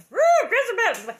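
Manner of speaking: shrieked accusation, high and loud; hammed-up crazy lady shriek. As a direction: scream it like a fire-and-brimstone zealot. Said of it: "the crazy lady is like".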